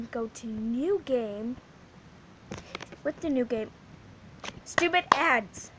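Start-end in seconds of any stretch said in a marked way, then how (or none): 4.6-5.7 high, slow, put-on grumble at the ads; stupid ads, said with a high mock whine; annoyed grumble. Real, not acted. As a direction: drag it out in disgust.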